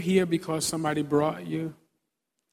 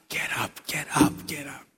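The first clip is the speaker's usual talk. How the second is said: whisper. Retold or imitated. Repeated urging to wake.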